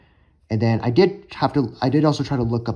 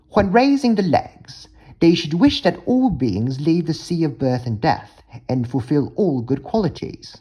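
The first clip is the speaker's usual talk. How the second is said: higher-pitched attempt at a British accent; British English accent; 6 semitones higher than usual. Shown as a demonstration. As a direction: read it out in a practised British accent. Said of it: "British English accent".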